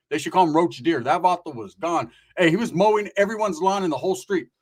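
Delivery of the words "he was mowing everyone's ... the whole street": normal talking voice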